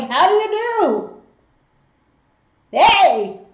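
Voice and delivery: loud, high, comic hello; mock-jaunty shout; hammed-up greeting. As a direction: call out a jokey, exaggerated how-do-you-do. Jokes the burn danced with devil.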